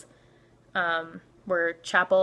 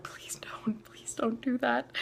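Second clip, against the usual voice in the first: hushed, drawn-out begging; whispered plea; 5 semitones higher, 6 dB quieter, slower than usual. Retold or imitated. Grabbing the drunk girl's hand, whispered pleading. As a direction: beg under your breath.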